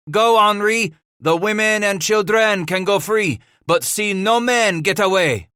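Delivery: high-pitched, loud urgent command; shouted order. A narrator's reading. Paul sends his brother after the fleeing figures, shouting orders.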